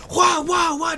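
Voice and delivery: high, shouted 'wah' blows; screaming punches. Retold or imitated. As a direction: imitate screaming while swinging. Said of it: "like, crying and screaming".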